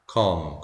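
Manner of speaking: 컴 said low and soft with a schwa; weak schwa. Shown as a demonstration. Teacher showing an unstressed syllable, 컴 weakened to a low schwa. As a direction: let 컴 drop into a weak "uh". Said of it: "강세 없으면 약하게".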